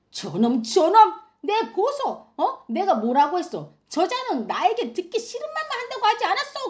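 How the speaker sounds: high, loud outburst as an offended king; acted royal tantrum; indignant king. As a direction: rail at that man in high-pitched outrage. Acts out king's angry outburst.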